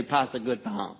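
Cajun accent, tongue kept low. Shown as a demonstration. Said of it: "then you sound like a Cajun".

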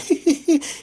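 mock high-pitched giggle; imitated laughing. Passenger laughing at him getting yelled at, mocking laugh. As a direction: imitate someone snickering, up high. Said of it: "dude in the bus is laughing".

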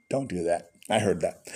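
low, soft, dry reprimand; quiet teasing warning to the audience; mock-stern aside. Real, not acted. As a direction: drop pitch and volume for a joking telling-off. Joking aside to congregation.

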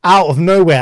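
ordinary talk

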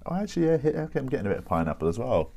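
tasting coffee, he finds pineapple as well, thrilled and fast; excited discovery. Real, not acted.

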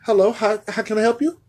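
high, bright service greeting; chirpy cashier at the window; drive-thru worker. Acted.

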